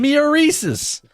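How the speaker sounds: high, slow cheerleader chant. Retold or imitated. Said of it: "like a cheerleader from the Reese's commercial".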